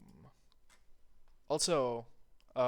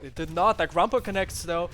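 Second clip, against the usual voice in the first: loud, rising hype call; excited commentary; 4 semitones higher, 9 dB louder than usual. Real, not acted. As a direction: call the hit with rising energy. Excited live commentary, big hit.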